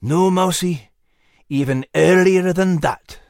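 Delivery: gruff dog voice, ominous; lightly voiced dog. A narrator's reading. Dog answering the mouse, lightly voiced. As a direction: warn him like an old dog.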